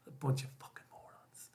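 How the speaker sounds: a muttered, contemptuous aside; whispered aside. Real, not acted. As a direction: whisper it under your breath, scornful.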